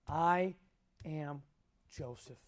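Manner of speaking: word by word, long pauses, falling away; dramatic reveal. A narrator's reading. Dramatic reveal read from scripture.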